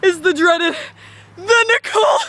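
dramatic announcer shout. Pretend. Dramatic reveal.

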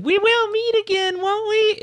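high-pitched, loud mock shout. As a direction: call it out in a hammed-up high yell. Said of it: "where he's shouting".

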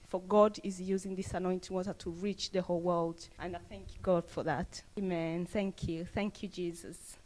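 usual speaking voice

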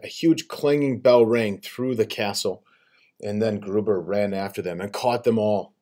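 ordinary delivery

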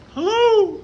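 loud high shout under the bridge; calling out for an echo. Real, not acted. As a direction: yell hello to test the echo. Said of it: "Ah, not much of an echo".

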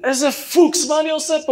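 high, loud yell into the intercom; shouting. Retold or imitated. Shouting to the pilot over the intercom, loud and high. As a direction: yell it, stunned and panicking. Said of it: "ואני צועק".

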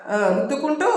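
usual speaking voice

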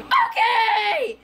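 high, drawn-out joke scream; imitated screaming catchphrase; mock scream. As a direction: belt it out in a silly high yell. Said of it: "compilation of you screaming".

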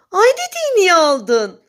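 shocked question. A narrator's reading. Wife to bear about the stolen moon, aghast. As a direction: demand it in disbelief.

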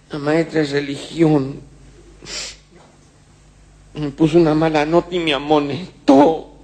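high, slow, lightly voiced little girl; a hint of a whiny little girl back from school. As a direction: complain like a hurt schoolgirl. Crying girl answers father.

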